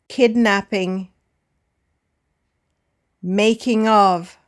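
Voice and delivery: DANC-ing, MAR-keting, with unstressed -ing; first-syllable stress. Shown as a demonstration. Teacher reads words for learners to repeat, stressing the start as in DUMP-ing. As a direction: model "dancing", "jogging", "planning" singly, stressing each first syllable. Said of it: "All have the stress on the first syllable".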